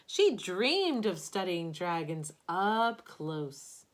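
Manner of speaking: expressive picture-book narration; storybook read-aloud voice; reading to children. Acted. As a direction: read it aloud to a class of kids. Reads picture book to class.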